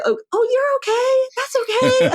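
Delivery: sweet high-pitched voice telling a stranger it's okay; consoling American. Retold or imitated. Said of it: "some people will look at me and say".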